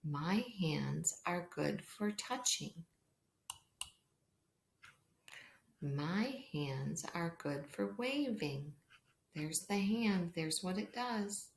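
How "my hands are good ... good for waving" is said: slow, hushed storybook reading; reading to a toddler. Acted. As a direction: read slowly and softly for young children. Reads pattern book aloud.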